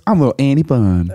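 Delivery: lightly put-on little old-time lady; Annie Bunn. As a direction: do a small character voice, lightly. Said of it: "And she talks like this".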